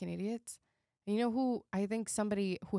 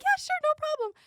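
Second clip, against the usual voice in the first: breezy high mock voice, louder; breezy casual; 6 dB louder than usual. Tiny woman offering her gun to the narrator, breezy high mock voice. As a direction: toss it off like it's nothing. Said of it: "She's like".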